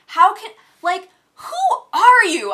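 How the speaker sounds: loud, high, slowing disbelief; high, loud, exasperated challenge; indignant outburst. Retold or imitated. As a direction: demand it in outrage. Venting at the rude valet.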